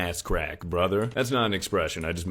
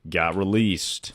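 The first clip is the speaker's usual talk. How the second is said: ordinary delivery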